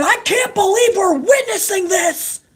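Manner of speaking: high, strained announcer scream; mock scream. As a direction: shriek it like a hyped commentator. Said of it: "And he's screaming".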